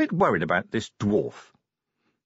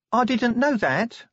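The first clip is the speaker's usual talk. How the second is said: high, slow hurt sniff; Tawny, sniffly; 12 semitones higher, slower than usual. A narrator's reading. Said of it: "Tawny sniffed".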